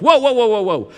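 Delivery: put-on whoa shouted high and loud to stop the kids; whoa, whoa, a loud repeated call to halt; mama's whoa. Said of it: "That's not this word".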